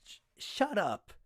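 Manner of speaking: high, loud, stammering brush-off; flustered stammer. Acted. Rat flustered by Glawin asking for a date, stammering. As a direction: stumble over it, embarrassed. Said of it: "Rat stammered for a moment".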